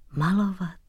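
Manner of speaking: put-on soft, frail old king sighing; feeble sigh. A narrator's reading. Old king answers the visiting painter, feeble sigh. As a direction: sigh it quietly, as if choked with grief. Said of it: "vzdychl si".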